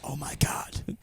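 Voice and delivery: whispered oh my God in awe. Retold or imitated. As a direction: breathe it out, stunned crowd. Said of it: "they were like".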